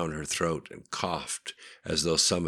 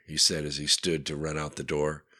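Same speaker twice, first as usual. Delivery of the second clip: usual speaking voice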